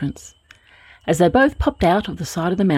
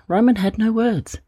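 ordinary delivery, nothing marked

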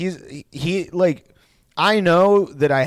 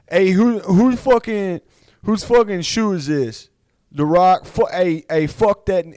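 loud, higher-pitched belligerent fighter; Nate Diaz; 5 semitones higher, 5 dB louder than usual. Retold or imitated. Fighter heckling a product display at a presser, loud and indignant. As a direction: challenge the room, loud and indignant. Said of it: "Nate Diaz was like".

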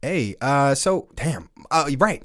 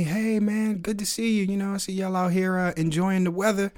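higher, lightly voiced friendly neighbor; imitating the neighbor; 7 semitones higher than usual. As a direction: mimic the chatty neighbor, laughing at the end. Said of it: "And he says to me".

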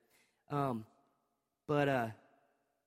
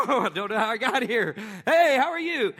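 higher and louder, laughing, playing dumb; mock innocent excuse; 5 semitones higher, 7 dB louder than usual. Preacher mocking a man who claims he stumbled into adultery, laughing. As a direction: act surprised to find yourself there.

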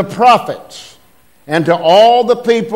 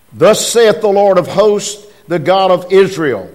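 measured, declaiming King James reading; scripture reading; 3 semitones higher, 4 dB louder than usual. Acted. Preacher reading Jeremiah aloud to the church, declaiming. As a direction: read the verse with preaching weight.

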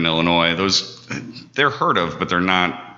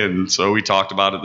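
ordinary talk, nothing marked

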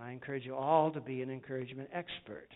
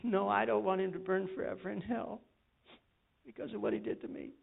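wife's hesitant answer; 5 semitones higher than usual. Retold or imitated. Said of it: "And she said".

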